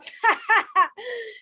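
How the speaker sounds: a real burst of high laughter; cracking up mid-sentence at herself; real laughing. Real, not acted. Coach on a live stream breaks into real laughter. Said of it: "laugh my ass out".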